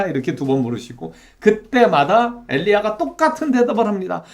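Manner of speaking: ordinary talk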